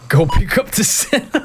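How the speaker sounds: can't believe he's being asked this; incredulous echo. Real, not acted. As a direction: echo it back in shock. Player echoes the game's order.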